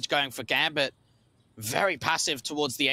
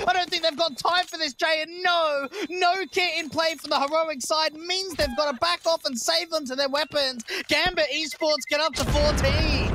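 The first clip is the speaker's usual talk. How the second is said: esports caster; 12 semitones higher than usual. Real, not acted. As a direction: shout the round's climax. Live play-by-play climax.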